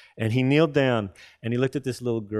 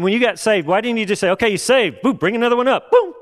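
mock brisk assembly line; 9 semitones higher, 6 dB louder than usual. Mocking instant-salvation routine.

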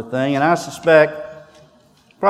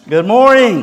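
Good morning thrown out like a cheer; loud greeting; 6 semitones higher, 5 dB louder than usual. Shown as a demonstration. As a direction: call out good morning to rouse the crowd. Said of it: "Sounded more like a soccer match there".

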